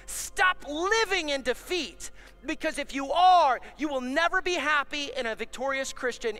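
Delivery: rally the families; loud exhortation. Real, not acted. Preacher calling out dads and moms, loud and urgent.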